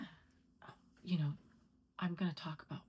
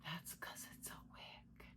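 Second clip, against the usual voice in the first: whisper; 2 semitones lower, 16 dB quieter than usual. Real, not acted. Revealing the wig secret.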